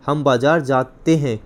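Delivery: slow, जाते broken before ते; teacher's slow जा-ते हैं; plural ending stressed. Shown as a demonstration. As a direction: draw out जाते to show the plural. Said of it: "plural हो गया".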